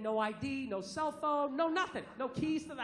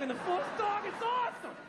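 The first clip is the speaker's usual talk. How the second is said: higher, giddy praise; gushing; 7 semitones higher than usual. Real, not acted. Comic gushing to the audience about his dog, higher and giddy. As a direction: sing the dog's praises.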